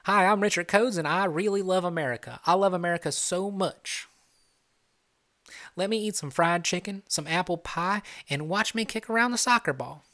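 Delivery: mock an Americanized Brit with an over-the-top Southern accent; Richard with Southern accent. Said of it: "That's how you're gonna sound".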